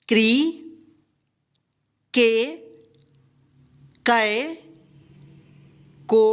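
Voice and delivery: teacher drilling the barakhadi aloud; slow recitation drill. Shown as a demonstration. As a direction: recite each syllable slowly with long pauses. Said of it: "सबसे पहले हम क का ककार देखते हैं".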